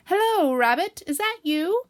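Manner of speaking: high, loud, hopeful hail; calling out. Acted. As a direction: call to a friend inside a hole. Pooh calls into the hole again.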